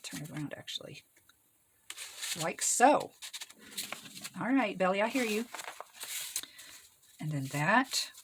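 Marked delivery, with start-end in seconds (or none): none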